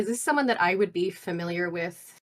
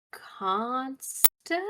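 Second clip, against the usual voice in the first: higher, questioning, staring off; distracted; 6 semitones higher, 4 dB quieter than usual. Preoccupied ghost answers the group's question, distracted. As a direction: repeat the name absently, as a question. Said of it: "kinda stares off".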